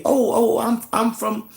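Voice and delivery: high, eager imitation of test takers; mock excitement. Mimicking people announcing DNA test results, mock excited. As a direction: blurt it high and stammering, mocking their excitement.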